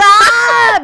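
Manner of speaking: high excited shriek; excited squeal. Real, not acted. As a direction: squeal with delight.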